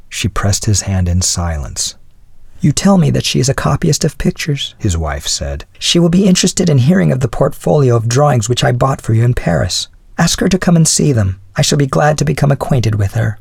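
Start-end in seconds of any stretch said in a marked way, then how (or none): 2.6-13.4 higher voice of an obliging wife; composed wife. Acted. Wife inviting her husband's new acquaintance, higher wifely voice. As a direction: play the wife graciously asking to meet her.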